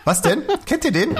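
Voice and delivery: normal talking voice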